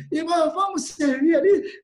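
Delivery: rally them with an eager question; rousing invitation. Acted. Preacher acting out inviting a brother, rousing call.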